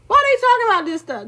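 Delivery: high, fast disbelieving question; incredulous. Acted. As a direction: throw up your hands, squeak it out fast. Mock outrage at media.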